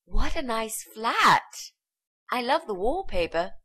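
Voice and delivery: high, drawn-out, swooping admiration; gushing compliment. Acted. Would-be nanny praising the mother's flat, gushing. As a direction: flatter the host effusively.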